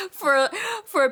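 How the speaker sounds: words said through a real laugh. Real, not acted. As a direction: giggle while finishing the thought. Laughs mid-sentence at herself.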